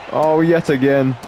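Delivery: ordinary talk, nothing marked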